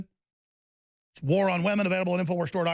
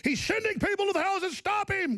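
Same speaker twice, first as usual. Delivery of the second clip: much higher, whiny mock alarm; mocking the Post; 12 semitones higher than usual. Host mocking the Washington Post's claim, high whiny mock panic. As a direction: parody a panicked reporter crying wolf. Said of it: "Oh, the Washington Post says".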